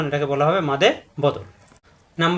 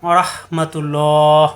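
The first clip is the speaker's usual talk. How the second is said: drawn-out, chanted salaam; slow solemn Islamic greeting; slow formal greeting; 4 dB louder, much slower than usual. Real, not acted. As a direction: greet the class slowly and solemnly. Formal greeting opening a class.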